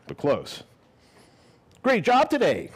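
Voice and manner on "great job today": high upbeat boss voice; boss's cheerful praise. Retold or imitated. New boss cheering the taco worker, high and upbeat. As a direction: chirp it like a pleased new manager.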